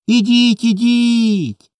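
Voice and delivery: put-on high-pitched children's calling. A narrator's reading. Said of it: "голосніше кликали хлопці".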